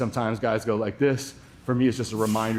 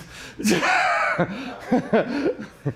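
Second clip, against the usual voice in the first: high-pitched, breaking laugh; burst of laughter; 11 semitones higher than usual. Real, not acted. Laughs at silly question.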